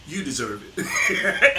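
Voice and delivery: ordinary delivery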